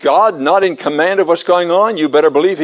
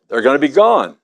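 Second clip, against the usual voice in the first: ordinary delivery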